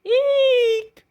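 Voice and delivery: piercing terrified eek; scared shriek. Acted. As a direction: shriek in sudden fright. Scared shriek during cat fight.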